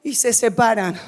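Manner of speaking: full-force pulpit preaching; fiery preaching. Acted. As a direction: preach it to the whole church.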